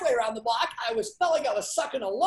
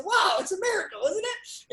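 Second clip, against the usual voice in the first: high-pitched playful wonder; retold tongue-in-cheek astonishment; mock amazement; 10 semitones higher than usual. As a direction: marvel teasingly, voice jumping way up. Teasing agent about his great week.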